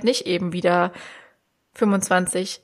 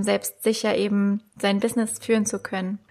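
ordinary talk

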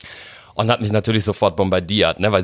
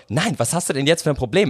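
higher-pitched exasperated questioning; retold impatience; 7 semitones higher than usual. Mother pressing child to speak up, mock exasperated demand. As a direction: imitate a mother losing patience.

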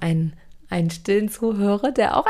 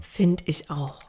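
soft whisper; 3 semitones lower, 3 dB quieter, slower than usual. Real, not acted. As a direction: whisper agreement. Whispered agreement.

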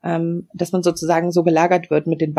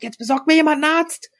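recreate yelling for a doctor, pitch up; retold yell; 9 semitones higher than usual. Patient yelling at staff to fetch a doctor, retold mock yell. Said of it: "habe ich wirklich geschrien".

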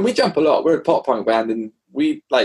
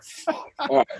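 stunned thanks; 12 semitones higher, 7 dB quieter than usual. Retold or imitated. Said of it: "I was like".

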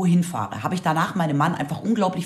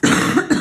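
sudden sharp cough; a real cough breaks out; coughing fit; 9 semitones higher, 5 dB louder than usual. Real, not acted. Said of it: "ich hab Husten".

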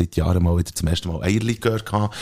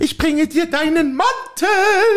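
a higher, fussy housewife voice pestering her husband; nagging wife; 3 dB louder, slower than usual. Acted. Wife nagging her husband to go for a walk, fussy. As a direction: play the nagging wife in a comic sketch. Acted dialogue sketch, wife role.

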